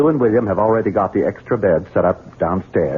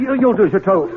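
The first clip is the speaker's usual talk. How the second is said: angry; 11 semitones higher, faster than usual. Acted. Forcing her into room.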